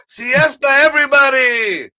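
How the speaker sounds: high, loud, hammed-up call to all; mock announcement. Aliens in sombrero spaceship calling down, high loud mock cry. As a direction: holler it like a party arriving.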